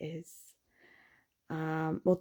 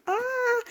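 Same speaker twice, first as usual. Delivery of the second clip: anguished squeal. Retold or imitated. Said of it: "and I'm like".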